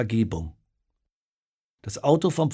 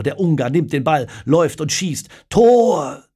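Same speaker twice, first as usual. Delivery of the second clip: match commentary; 5 semitones higher, 8 dB louder than usual. A narrator's reading. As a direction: build up to a high, loud goal cry. Kommentar zum zweiten ungarischen Tor.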